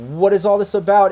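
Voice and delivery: lightly voiced woman stepping in to demand answers; female voice. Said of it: "you hear a female voice like".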